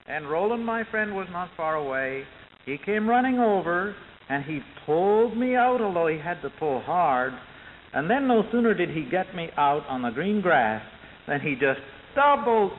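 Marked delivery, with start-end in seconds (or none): none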